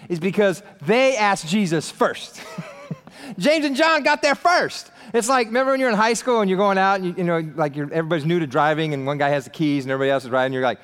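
ordinary delivery

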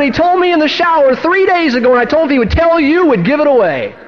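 high-pitched, delighted imitation; excited confirmation. Wife tells husband the Lord told her first, excited. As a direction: let it tumble out high and eager.

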